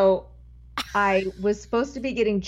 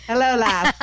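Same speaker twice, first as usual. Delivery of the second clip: put-on louder, higher Irish accent sliding into Scottish; bad Irish accent; 4 semitones higher, 5 dB louder than usual. Mocking own attempt at the boss, botched Irish-Scottish accent. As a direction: ham up a botched Irish greeting. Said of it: "that was a little bit of Scottish".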